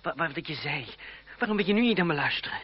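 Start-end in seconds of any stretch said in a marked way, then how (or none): 1.4-2.6 loud, fast, pleading anger; angry outburst. Acted. André to his cousin, loud and fast in anger. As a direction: demand to be heard, voice raised.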